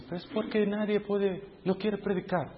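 a hushed private thought; thinking to himself. Retold or imitated. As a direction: wonder quietly why nobody volunteers. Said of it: "Y yo dije".

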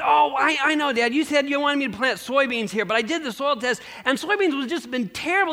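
proud son showing off his cabbage; eager son explaining. Acted. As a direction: son talking fast and proud about the cabbage.

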